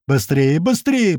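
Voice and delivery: urgent call climbing high; narrated call to hurry; hurrying them. A narrator's reading. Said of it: "поторапливает нас кто-то".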